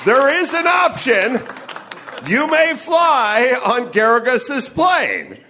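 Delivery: loud, high, drawn-out announcement; mock-formal ringing pronouncement; mock proclamation. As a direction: proclaim it grandly to the whole crowd. Mock proclamation of the costly option.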